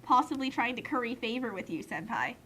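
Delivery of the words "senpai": usual speaking voice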